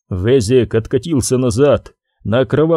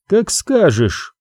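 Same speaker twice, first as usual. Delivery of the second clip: smug; 7 semitones higher, slower than usual. Acted. Guard's mocking reply.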